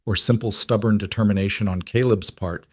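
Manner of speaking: ordinary delivery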